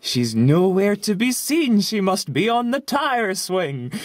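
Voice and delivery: lightly voiced little brother speaking from nowhere; eerie, distant kid's voice, unhurried; disembodied child voice. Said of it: "the disembodied voice of my little, like, baby brother".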